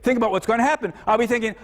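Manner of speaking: normal talking voice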